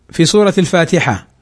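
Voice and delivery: normal talking voice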